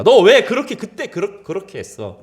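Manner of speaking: rebuke. Pretend. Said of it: "책망이 아닙니다".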